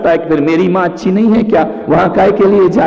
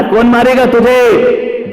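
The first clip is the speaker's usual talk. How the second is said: mock reassurance; 7 semitones higher, 5 dB louder, slower than usual. Father reassuring son.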